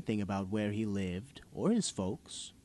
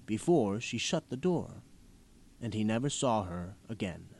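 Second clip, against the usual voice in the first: normal talking voice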